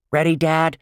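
put-on high calling kid; eager kid voice. A narrator's reading. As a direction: call up to dad eagerly. Boy calling to his dad.

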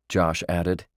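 ordinary delivery, nothing marked

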